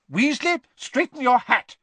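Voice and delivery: high, loud scolding; Professor McGonagall snapping. A narrator's reading. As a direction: snap an order at a pupil. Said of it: "Professor McGonagall snapped at Ron".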